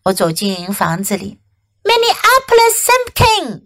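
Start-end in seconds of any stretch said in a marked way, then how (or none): 1.8-3.6 high-pitched mild storybook yell; mother's yell. A narrator's reading. Mom calls her child home, high-pitched mock yell. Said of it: "yelled Mom".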